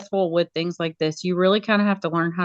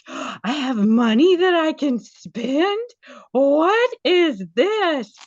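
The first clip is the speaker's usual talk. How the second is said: high, drawn-out gasping disbelief; gasp, then marvel slowly with swooping high pitch; mock amazement; 8 semitones higher, much slower than usual. Media specialist marveling at her new budget, mock amazed.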